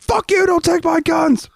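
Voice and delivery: hammed-up angry gun owner shout. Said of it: "your population went".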